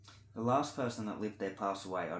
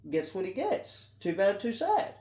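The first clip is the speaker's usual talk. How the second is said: mock callous crowd, higher pitched; raised-pitch scornful brush-off, acted out; dismissive onlookers; 7 semitones higher, 4 dB louder than usual. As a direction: imitate people shrugging off someone's misfortune. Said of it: "they're gonna go".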